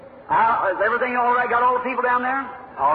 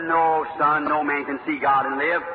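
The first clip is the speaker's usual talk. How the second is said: a hint of a lower, grave pastor; lower, grave pastor voice answering the boy; lightly voiced pastor; 7 semitones lower than usual. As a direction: settle the boy gently as the old pastor.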